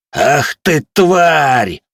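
high, loud enraged yell; furious shout. Acted. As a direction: scream the insult in rage. Dwarf loses his temper.

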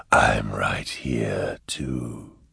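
low, very slow breathy whisper; eerie unseen voice. A narrator's reading. Demon voice greeting a man lost in dust, eerie whisper.

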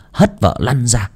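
plain reading voice

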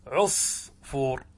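عصفور said slowly, syllables apart; slow demo of عصفور. Shown as a demonstration. Teacher to class, عصفور drawn out slowly. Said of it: "فعيعيل".

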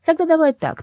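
high, loud sudden eagerness; eager bargaining. Acted. Soul tempting Lyolya with a new deal, high and eager.

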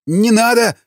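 высокий стон мольбы, «надо» протяжно; умоляй со стоном; простонал. A narrator's reading. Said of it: "простонал тот". (пленный стонет, умоляя не рубить руку)

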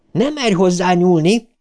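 high, fierce warning yell; howled warning. A narrator's reading. Beverly warns the spider off Bill, howling. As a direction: raise the pitch and hold it there. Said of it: "üvöltötte a pókra".

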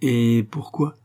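a touch of perfidious coaxing; slyly insinuating. A narrator's reading. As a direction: ask it with false innocence. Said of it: "d'une voix perfidement insinuante".

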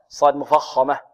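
usual speaking voice

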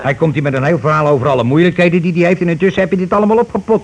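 indignant rant. Acted. Indignant about the hoarded cash.

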